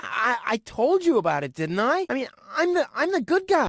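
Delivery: high, stammering protest; panicked self-defence. Acted. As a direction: plead innocence, pitch climbing high. Cornered man defends himself.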